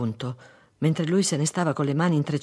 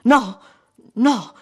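high, loud plea; loud pleading denial; imploring; 8 semitones higher, 9 dB louder than usual. A narrator's reading. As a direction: beg him with a raised cry. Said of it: "implorò lei".

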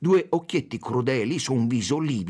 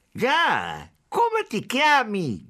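high, drawn-out yell; shouting; 13 semitones higher, 4 dB louder, much slower than usual. A narrator's reading. Second policeman demanding the consul's name, high shout. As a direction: bawl the question, pitch leaping up. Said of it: "gridò il secondo poliziotto".

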